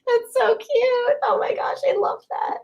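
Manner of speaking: very high, squeaky through laughter; laughing delight. Real, not acted. Streamer tickled by a viewer's cute joke, high laughing squeal. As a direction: squeeze the words out while laughing.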